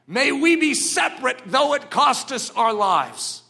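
high, loud, slow preaching shout; a pastor defying the assembly before the KGB; defiant proclamation. Retold or imitated. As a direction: thunder it out to the whole hall. Richard's defiant speech to pastors.

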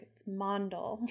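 "Mondal" said with a broad "ah": MAHN-dal; MAHN-dal, not Mandel; corrected pronunciation. Shown as a demonstration. Host correcting her own misreading of Mondal. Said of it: "I have to be like".

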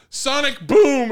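high, slow, booming yell; shouted catchphrase. Retold or imitated. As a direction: belt it out like a cartoon hero. Quoting a character's yell.

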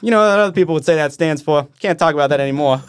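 mock Big Earl heckling; gruff New Yorker impression. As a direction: ham up the gruff stagehand's greeting. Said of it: "this is how he introduces".

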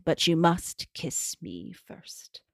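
slow, unvoiced hag whisper; gravelly whisper. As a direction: whisper it like an old crone. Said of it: "the guardian makes her offer".

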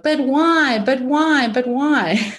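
high insistent why, sinking each time; insistent repeated why. Retold or imitated. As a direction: nag yourself, losing steam. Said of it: "'Cause we go".

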